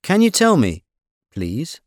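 normal talking voice

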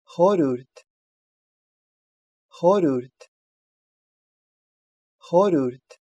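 "Хорюрт" with the h after r silent; teacher's careful "Хорюрт", h dropped, final t released; demonstrated pronunciation. Shown as a demonstration. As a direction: model "Хорюрт", gliding from r into the vowel without h. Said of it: "не произносится".

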